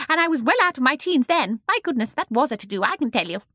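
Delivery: put-on high, loud, excitable little old lady; chatty old woman. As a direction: chatter on like a lively old shopkeeper. Voices old Mrs Corrie.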